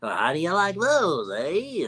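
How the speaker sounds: high, drawn-out showing-off; high-voiced little braggart; show-off character voice. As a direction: show off proudly in a high cartoon voice. Acting out Squinch showing off gems.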